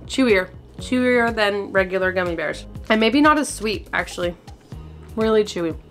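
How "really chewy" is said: ordinary talk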